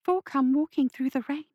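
put-on a vacant, doll-like girl; eerie little girl. Vacant child Lydia speaks.

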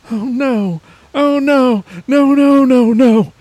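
high, loud panicked cries. Acted. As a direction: shriek in panic. Panicked man.

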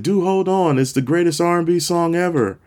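high, pushed mock yell; insistent call-out. Fan insisting to the singer on his song, called out. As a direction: call it out proudly to the stage.

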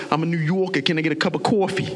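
put-on fast, light New York accent; hint of a New York accent. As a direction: toss it off New York style. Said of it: "You go to New York, it's like".

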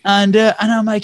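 usual speaking voice

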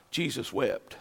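very soft and low; hushed verse reading. Real, not acted. Preacher reads his favorite verse, hushed and low. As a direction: drop right down for the short verse. Shortest verse, first read softly.

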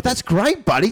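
put-on very high, sing-song praise for a toddler; exaggerated kindergarten teacher voice. Shown as a demonstration. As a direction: gush over a child's drawing. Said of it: "kindergarten teacher voice".

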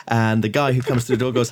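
ordinary delivery

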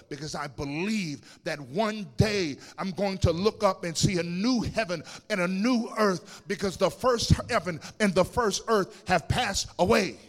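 loud, soaring preacher cadence; loud sung-out preaching, climbing toward the climax; full-force preaching. Acted. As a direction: preach it to the rafters, building.

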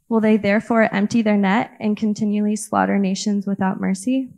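loud, earnest Bible reading; scripture reading. Acted. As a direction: read it out boldly to the church. Reads scripture aloud to congregation.

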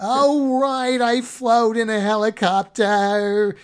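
loud, high-pitched hammed-up comic impression; Jerry Lewis. Clowning for the co-host about a helicopter flight, loud comic impression. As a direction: belt it out like a zany comedian. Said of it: "I think I was going Jerry Lewis".

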